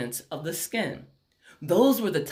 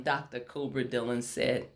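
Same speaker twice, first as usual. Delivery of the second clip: usual speaking voice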